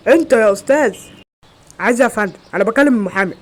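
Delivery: loud scolding shout; shouted reprimand, pitch lifted at first; yelling. Acted. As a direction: raise your voice and tell him off. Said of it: "كده يزعق معايا".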